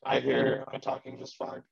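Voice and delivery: ordinary talk, nothing marked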